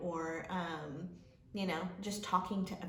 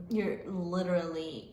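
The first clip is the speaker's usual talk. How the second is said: ordinary talk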